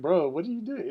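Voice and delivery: retold disbelief, pitched high and loud; incredulous reaction. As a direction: question your friend in loud, high-voiced disbelief. Retold disbelief at friend's outburst.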